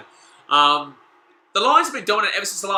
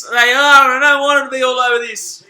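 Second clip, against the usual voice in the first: dismayed wail; 7 semitones higher, 12 dB louder than usual. Real, not acted. Fan answering viewer's comment on rivals' dominance, dismayed wail.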